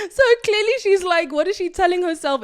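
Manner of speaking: high, loud burst of laughter while talking; real laughter. Real, not acted. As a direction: crack up at her own joke. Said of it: "laughing at herself".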